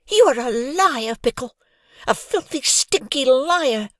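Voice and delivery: high, loud furious yell; furious outburst. Acted. Hoggy shouting at the bully, furious and shrill. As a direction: scream the insult in rage. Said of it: "blood rushing to her face".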